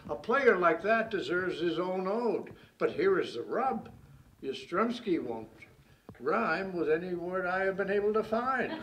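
emphatic, bouncing rhymed verse; mock-grand poem building to a punchline; comic ode recital. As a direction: declaim the ode in a steady meter.

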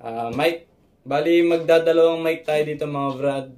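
normal talking voice

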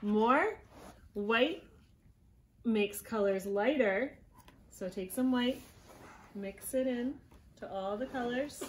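plain reading voice

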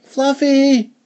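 high sing-out to a dog; girl calling her dog. Acted. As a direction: call the pet, long and high. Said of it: "the daughter called the dog".

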